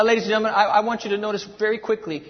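normal talking voice

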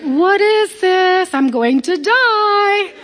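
high, slow, horrified gasp at the strange house; shocked. Retold or imitated. Speaker recalls entering Granny Silver's house, mock horrified. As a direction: voice jumping up in mock alarm, half laughing. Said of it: "And I was like".

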